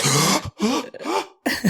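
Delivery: sharp high gasp of shock; startled gasp. Retold or imitated. Realising he's in the drummer's house, a shocked gasp. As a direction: gasp as it all clicks. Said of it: "I'm, like, freaking out".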